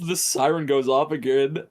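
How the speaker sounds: ordinary talk, nothing marked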